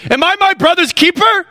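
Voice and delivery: high, indignant mock protest; hammed-up outraged denial; defensive retort. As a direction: act offended at being asked. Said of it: "What do people do when you point out their sin?".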